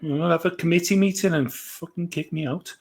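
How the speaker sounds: high, soft Welsh lilt; attempted Welsh accent. Shown as a demonstration. Fearing Welsh people will mock them, high, soft Welsh accent. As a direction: Welsh accent gone oddly sultry. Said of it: "Why do you sound like a porn?".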